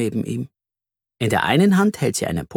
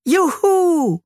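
high bright whoop of joy; a child cheers as they rush out to play football, joyful whoop; cheering child; 4 dB louder than usual. A narrator's reading.

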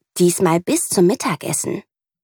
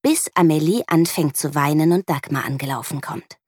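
usual speaking voice

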